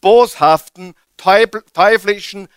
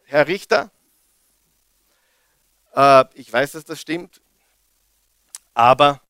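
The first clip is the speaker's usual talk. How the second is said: defender's plea; 6 semitones lower, 3 dB quieter than usual. Retold or imitated. Jesus as defender speaking gently to the judge, low and calm. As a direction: calmly assure the judge the debt is paid. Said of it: "Was sagt unser Verteidiger?".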